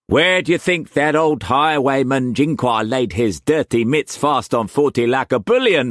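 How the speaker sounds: high, loud Cockney pirate-trader voice; Cockney swagger, pitched well up and pushed; harsh Cockney accent. A narrator's reading. Wu Kwok pressing food on Struan, in harsh Cockney accent. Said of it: "the harsh Cockney accent".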